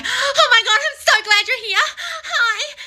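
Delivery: high, hammed-up squealing delight with laughter; mock gushing. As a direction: parody an overexcited newlywed, squeaky and giggly. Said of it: "Married people don't wake up every morning going".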